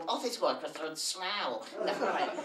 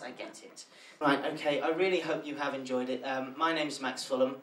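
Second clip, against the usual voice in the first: ordinary delivery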